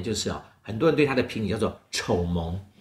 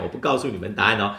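usual speaking voice

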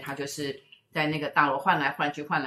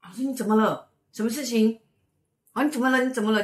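higher, anxious repeated asking; worried friend; 7 semitones higher, 4 dB louder, faster than usual. Retold or imitated. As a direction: plead like a friend who is scared for her. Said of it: "他说".

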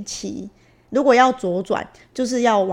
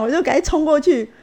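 ordinary delivery

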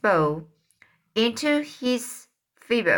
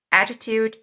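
plain reading voice

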